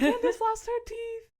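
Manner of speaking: very high, loud, slow wail; frantic shouting. Retold or imitated. Repeating the accident to friends at the car, frantic shouting. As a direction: holler it as urgent news.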